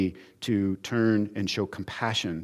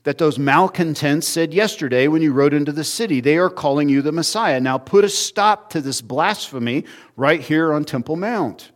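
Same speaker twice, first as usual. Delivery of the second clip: higher, insistent mock outrage; indignant demand; 5 semitones higher, 5 dB louder than usual. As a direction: demand he stop it, mildly hammed up. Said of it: "what they're really saying is".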